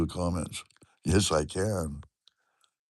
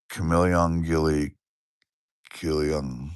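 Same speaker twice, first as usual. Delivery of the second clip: slow, careful try at "Chameleon Gilly"; carefully reading a viewer's name aloud; careful name attempt; 5 semitones lower, slower than usual. Shown as a demonstration. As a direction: sound out "Chameleon Gilly" slowly. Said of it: "I'm gonna try to say your name again".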